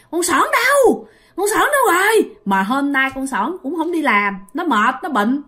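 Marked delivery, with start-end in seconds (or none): 0.1-2.3 much higher, strange demanding voice; possessed girl's voice. Retold or imitated. Possessed girl demands to know where Sỏn is, eerie high voice. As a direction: call out like a spirit in her body.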